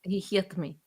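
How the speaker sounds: mock Greek accent, said softly; quietly put-on Greek accent. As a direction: do a soft, jokey Greek accent. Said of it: "that's my Greek accent".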